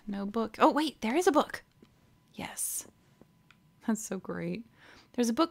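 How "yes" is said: whispered little yes of triumph. Real, not acted. Player finds the book and whispers a pleased yes. Quiet excitement at finding book.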